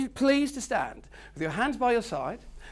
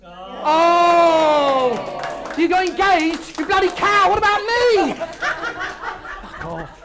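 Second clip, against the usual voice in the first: loud, high hammed-up jealous shriek; mock outrage; 9 semitones higher, 10 dB louder, much slower than usual. Trainer teasing a newly engaged delegate, mock outraged shout. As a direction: fake fury at the happy news, all in fun.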